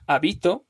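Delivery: habito stressed on the middle BI; stress on middle syllable. Shown as a demonstration. Teacher showing meaning changes with stress, habito stressed on BI. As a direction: lean on BI in habito. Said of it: "cómo vaya a pronunciarlo".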